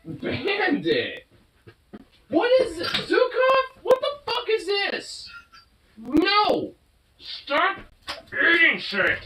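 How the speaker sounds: high, sharp scolding cry at the dog; yelling at the dog. Real, not acted. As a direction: stop him from eating junk, high and sharp. Dog chews something, owner yells.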